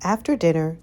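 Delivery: ordinary delivery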